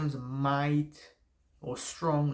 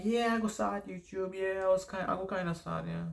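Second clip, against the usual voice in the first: mock noncommittal hedge, pitched a bit higher; half-hearted; 5 semitones higher than usual. Telling friends he'll start YouTube, half-hearted and vague. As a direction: say it like you don't really mean to do it. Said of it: "I used to also start like this".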